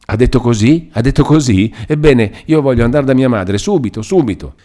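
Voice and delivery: high, loud urgent demand; agitated insistence. A narrator's reading. Captive demanding to go to her mother at once, agitated and insistent.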